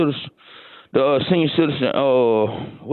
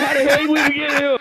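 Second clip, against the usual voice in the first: shocked quote; 11 semitones higher than usual. Retold or imitated. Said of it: "I'm like".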